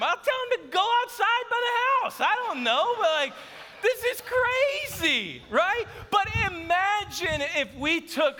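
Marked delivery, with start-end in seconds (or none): 0.0-2.2 high-pitched comic retort; exasperated. Retold or imitated. Husband retorts to wife about bathroom cleaning, exasperated. 3.8-5.9 high, slow, drawn-out mock protest; exasperated. Husband sums up the cleaning demands, exasperated. Acted exasperation at house rules.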